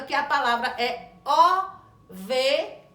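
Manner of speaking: ovelha drawn out slowly with a rise; slow emphasis. Shown as a demonstration. Teacher stressing the target word, slow ovelha. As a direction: stretch the phrase out, lifting on ovelha. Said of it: "pra que a criança perceba".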